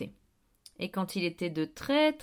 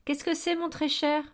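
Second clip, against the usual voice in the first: higher, nervous little question; timid, higher-pitched worried query; 7 semitones higher than usual. A narrator's reading. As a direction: ask anxiously, peering over. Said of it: "questionna nerveusement".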